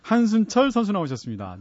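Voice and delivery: fight promoter. Pretend. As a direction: announce the boxer's entrance. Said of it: "장내 아나운서처럼 소개할 수 있으면".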